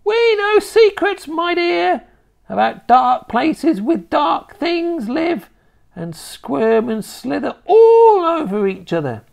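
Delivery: very high, loud hag; shrieking witch. A narrator's reading. Witch rising to frenzy.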